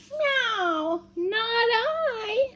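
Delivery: high cat voice with a meow. Acted. Voicing the cat.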